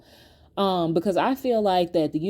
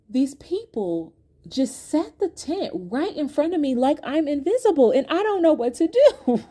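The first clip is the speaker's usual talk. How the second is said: mock distressed complaint; 6 semitones higher than usual. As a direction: plead helplessly, hamming up the upset. Retold upset call to husband.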